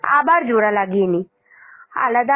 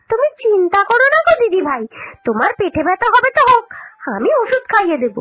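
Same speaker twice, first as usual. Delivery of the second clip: higher, playful gloating; gleeful teasing; 7 semitones higher than usual. Acted. Younger sister-in-law teasing the elder about her curse, higher and gloating. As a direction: rub it in cheerfully, pitched up.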